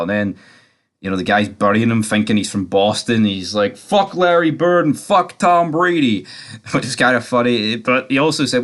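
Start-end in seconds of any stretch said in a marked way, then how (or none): 3.8-6.3 high, drawn-out imitation of a taunt; mock taunt. Guy taunting a supposed Bostonian, mock jeer. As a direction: jeer it slowly, pitched well above your voice. Retelling a guy's mocking taunt.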